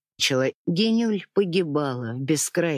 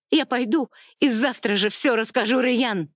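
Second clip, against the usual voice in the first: much higher, defiant threat; desperate threat; 9 semitones higher than usual. A narrator's reading. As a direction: throw the threat at him. Wife threatens husband. (жена грозит мужу, выше)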